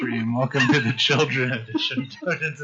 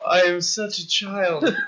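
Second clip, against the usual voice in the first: laughing; 10 semitones higher, 4 dB louder, much slower than usual. Real, not acted. GM giggling after fart noise.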